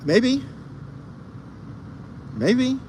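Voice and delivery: very high, sing-song maybe; coy high maybe. Pretend. Teasing chat, coy high-pitched maybe.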